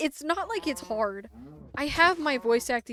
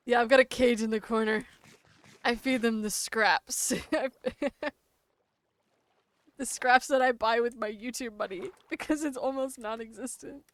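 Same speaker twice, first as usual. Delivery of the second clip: usual speaking voice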